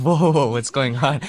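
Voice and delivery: ordinary talk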